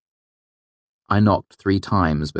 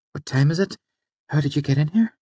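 high, light female character voice; puzzled woman asking, pitched well up; surprised young woman; 9 semitones higher, faster than usual. A narrator's reading. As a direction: lift into a higher woman's questioning voice. Narrator voices startled Fela questioning intruder.

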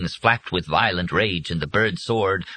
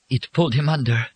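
higher, hushed-style stricken voice, still voiced; understated shock in a higher voice; stricken whisper; 6 semitones higher than usual. A narrator's reading. Molly watching him dragged under, mildly stricken. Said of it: "Molly whispered in a stricken voice".

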